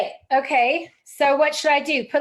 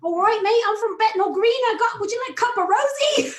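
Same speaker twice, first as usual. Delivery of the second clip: high-pitched, hammed-up Cockney greeting; Cockney accent; 7 semitones higher than usual. Shown as a demonstration. [name] greeting Tony Isabel on request, hammed-up Cockney accent. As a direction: put on your best Cockney, pitched right up. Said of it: "do your best Cockney accent".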